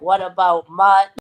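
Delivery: half-hearted imitation of yelling at him; retold yelling. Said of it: "I'm yelling at him".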